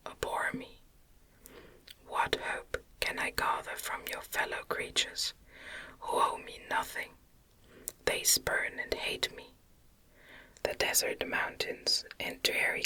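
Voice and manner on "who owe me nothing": ordinary delivery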